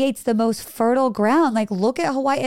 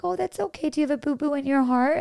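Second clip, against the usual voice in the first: tender teacher; 7 semitones higher than usual. Retold or imitated. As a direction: coo it gently to a hurt little kid. Teacher soothing a hurt child.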